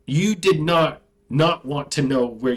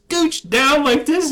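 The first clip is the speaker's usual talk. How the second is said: normal talking voice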